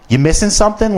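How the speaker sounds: high, mocking gotcha question; smug manager. Manager holding up the pen, smug gotcha tone. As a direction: dangle the bait like you caught him. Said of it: "and he goes".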